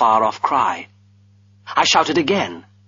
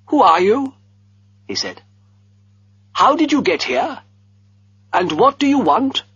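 put-on higher, demanding old master, alarmed; stern old master; 11 semitones higher, 3 dB louder than usual. A narrator's reading. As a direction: challenge the intruder sharply.

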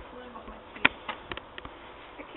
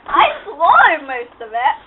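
lips sticking to the teeth; mouth full; 8 dB louder than usual. Real, not acted. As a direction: talk through a sticky mouthful. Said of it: "my lips are stuck to my teeth".

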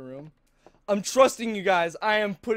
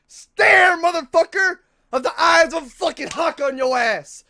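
angry yelling; 7 semitones higher, 7 dB louder than usual. Real, not acted. Yelling at game monster.

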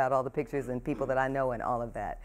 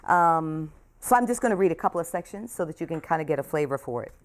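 usual speaking voice

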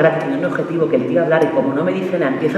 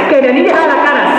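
higher, pushier repeat of the demand; insistent demand; 6 semitones higher than usual. Acted. Girlfriend demanding her boyfriend look at her, insistent. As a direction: insist harder the second time.

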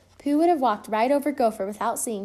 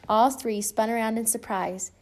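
usual speaking voice

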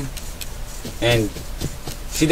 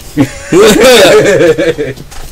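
loud laugh; 4 semitones higher, 5 dB louder than usual. Real, not acted. Friend laughing at game.

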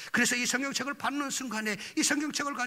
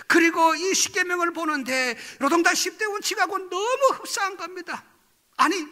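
normal talking voice

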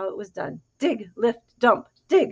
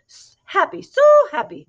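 rising very high and loud; chirpy chicken; 10 semitones higher, 8 dB louder than usual. A narrator's reading. Other chicken chimes in to the pigs, high and eager. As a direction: trill it like an excited chicken. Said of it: "said the other chicken".

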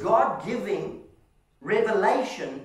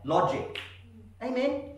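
ordinary talk

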